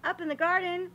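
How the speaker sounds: lively, sing-song picture-book reading; reading to children. A narrator's reading. Reading a garden picture book to children, lively storytelling. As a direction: read it like story time for little kids.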